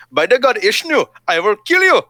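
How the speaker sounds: hammed-up Indian accent, high and loud; high-pitched, put-on Indian accent. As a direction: cry out a mock threat in an Indian accent. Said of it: "do an Indian accent".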